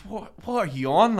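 what are you on, sharp and incredulous; incredulous challenge. Retold or imitated. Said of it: "I goes".

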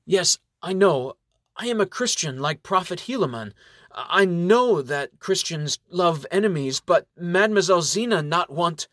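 a lightly voiced earnest elf in halting English; Lynx, earnest elf. Elf Lynx tells the reverend he loves enemies, earnest.

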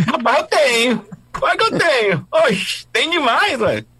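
high, exaggerated northeastern Brazilian accent; nordestino accent. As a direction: play it as a comic nordestino character. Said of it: "um personagem nordestino".

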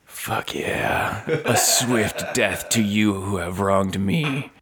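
hushed, slow creature-like echo of a vow; talking arrow. Acted. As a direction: play the arrow answering in a drawn-out hush. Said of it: "The arrow goes".

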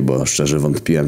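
ordinary delivery

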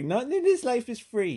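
mimicked Jersey accent, pitched well up; thick Jersey accent. Storyteller mimicking a lady leaving her house, thick Jersey accent. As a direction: put on a high mock Jersey accent. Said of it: "in her thick Jersey accent".

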